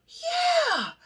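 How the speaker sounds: very high, drawn-out mock squeal; hammed-up amazed falsetto. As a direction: squeal it as if found out. Startled model being mind-read.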